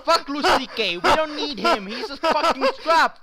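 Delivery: high, loud gushing; overexcited. Pretend. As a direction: cheer it out, way too thrilled. Overexcited audience praise.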